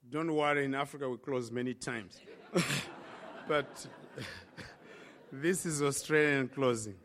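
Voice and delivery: low, soft chuckling aside; amused aside. Real, not acted. Guest preacher teasing the congregation about closing, amused low aside. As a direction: drop the preaching voice and joke with a chuckle.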